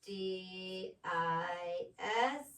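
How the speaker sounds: letters slow and separate, D I S M I S S; each letter its own beat; spelled out slowly. Shown as a demonstration. Teacher to pupil, spelling the word out slowly. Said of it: "spell it out".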